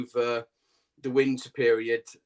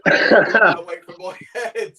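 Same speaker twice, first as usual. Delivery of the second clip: laughing through the words; words broken by a real laugh; 8 semitones higher, 11 dB louder, much slower than usual. Real, not acted. Laughing about the spider.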